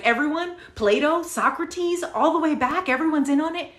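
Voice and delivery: high-pitched disbelieving questions; incredulous challenge. Retold or imitated. Incredulous retort, start of argument.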